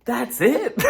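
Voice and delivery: high, disbelieving question; incredulous. Retold or imitated. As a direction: blurt it in mock disbelief, nearly laughing. Teller's incredulous reaction, then laughs.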